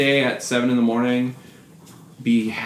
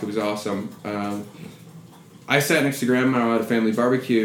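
ordinary talk, nothing marked

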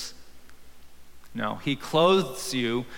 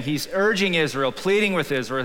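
ordinary talk, nothing marked

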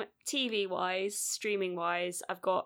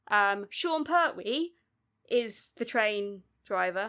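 usual speaking voice

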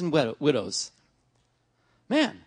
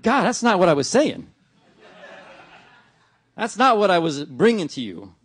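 louder, flustered protest with a laugh; a retold indignant complaint to God; exasperated protest; 6 dB louder than usual. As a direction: push back at God in disbelief. Protesting God's answer in prayer.

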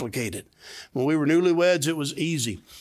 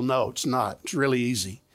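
ordinary talk, nothing marked

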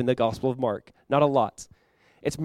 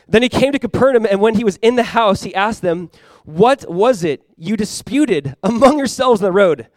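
raised, deliberate reading voice; scripture reading; 6 semitones higher, 6 dB louder than usual. Acted. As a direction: read the verse out to the congregation, loud and measured. Reads scripture aloud.

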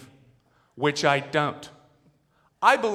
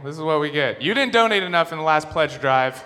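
ordinary delivery

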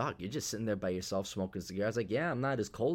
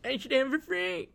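high mocking whine; mock an entitled freeloader; high put-on whine insisting on free stuff. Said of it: "crap bird and think, like".

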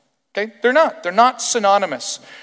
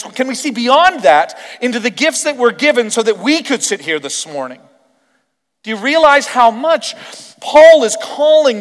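full-voiced, louder sermon delivery; fervent pulpit preaching; 7 semitones higher, 9 dB louder than usual. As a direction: preach it out to the room, rising. Preaching to the congregation.

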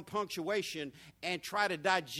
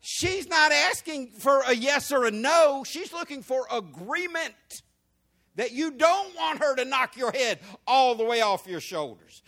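loud preaching; 6 semitones higher, 8 dB louder than usual. Acted.

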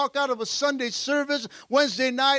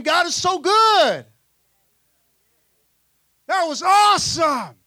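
shouting; 3 semitones higher, 6 dB louder than usual. Acted. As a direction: shout it as an elated disciple in the boat. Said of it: "Man, they're shouting".

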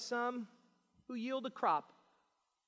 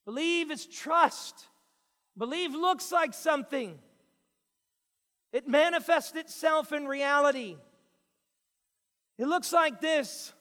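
preaching; 5 semitones higher, 8 dB louder than usual. Acted. Pastor preaching to his congregation, loud and emphatic. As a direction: drive each point home to the church.

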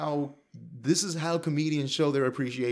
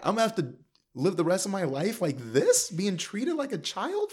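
high, incredulous questioning; mock exasperated veteran; 7 semitones higher than usual. Voices veteran fed up with coddling.

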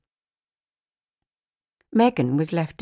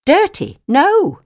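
high, loud sharp scolding; scolding shout; 13 semitones higher, 12 dB louder, slower than usual. Acted. Owner shouting at the dog to get off. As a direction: yell at the dog to stop.

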